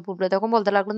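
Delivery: usual speaking voice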